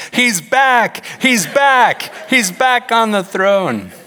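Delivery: high, loud, hammed-up celebration; jubilant shouting. Pastor celebrating on his desk, imitated jubilant shouts. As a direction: shout it like you're jumping up and down. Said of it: "he starts jumping up and down".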